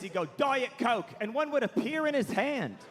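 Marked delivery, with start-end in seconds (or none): 0.3-1.1 higher, imperious British drawl. Retold or imitated. Rock star demanding a drink, curt and entitled. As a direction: summon a drink with a drawled command. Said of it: "[name] would go like this".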